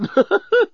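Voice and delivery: high-pitched helpless giggling; intoxicated titter; tipsy giggle. A narrator's reading. Journalist overcome by the scent, giggling. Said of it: "had started giggling".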